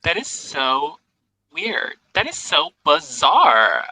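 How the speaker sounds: put-on high, slow, drawn-out Valley Girl. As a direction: stretch every vowel like a Valley Girl. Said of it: "Valley Girl insults".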